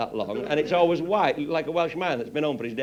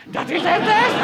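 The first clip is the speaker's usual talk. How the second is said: high squeal of alarm, much louder; panicked mock cry; 10 dB louder than usual. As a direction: yelp it in a hammed-up panic. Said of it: "Wurster sliding under the table going".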